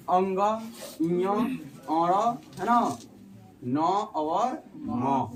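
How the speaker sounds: ञ, अण, न, म each stretched very slowly; slow nasal recitation; nasal letters drawn out. Shown as a demonstration. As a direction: hum each nasal letter long through the nose. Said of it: "नाक का भी जो है इसमें उच्चारण".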